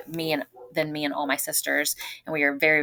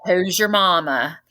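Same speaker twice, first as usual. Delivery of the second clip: slow imitated North Louisiana drawl; Southern accent; 2 semitones higher, 4 dB louder, slower than usual. Dad questioning his newfound daughter, imitated Southern accent. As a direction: draw it out like a country Southern dad. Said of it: "Southern accent, he said".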